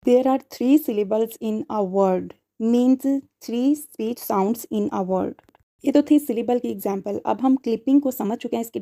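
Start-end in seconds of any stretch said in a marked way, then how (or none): 0.1-5.3 very slow, spaced-out teaching; teacher dictating an explanation slowly. Shown as a demonstration. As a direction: let each word land slowly. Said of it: "teacher explains syllables slowly".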